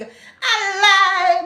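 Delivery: very high, loud cry of A lie!; big disbelieving cry. Retold or imitated. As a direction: scream out your disbelief at the gossip. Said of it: "I had to give out a big".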